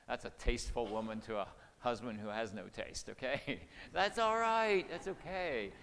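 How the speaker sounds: normal talking voice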